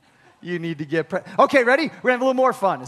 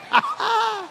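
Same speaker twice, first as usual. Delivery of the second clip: real laugh; 10 semitones higher than usual. Real, not acted. Preacher laughs at audience reaction.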